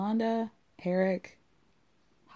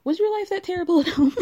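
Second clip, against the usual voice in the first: pitched high and louder, hammed-up despair; mock-anguished mother; 10 semitones higher, 5 dB louder than usual. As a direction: plead like a dramatic mom. Breaks into laughter reading.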